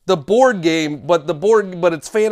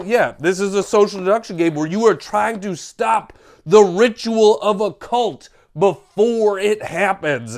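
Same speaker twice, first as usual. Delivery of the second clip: normal talking voice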